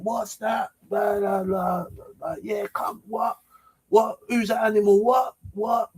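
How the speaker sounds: high-pitched mock yell, held back in volume; confrontational challenge. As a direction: imitate calling someone out down the road. Retold shouting back from the road.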